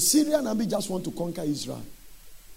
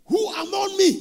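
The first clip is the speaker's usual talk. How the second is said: angry king shout; 11 semitones higher, 5 dB louder than usual. Acted. Angry king demands the traitor.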